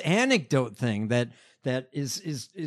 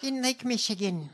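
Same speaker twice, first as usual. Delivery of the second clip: mocking politician impression; 6 semitones higher than usual. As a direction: draw out the parody in a whispery voice. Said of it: "You sound insane".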